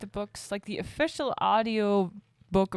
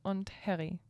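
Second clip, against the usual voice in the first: German pronunciation; 7 dB quieter than usual. Pretend. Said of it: "German accent".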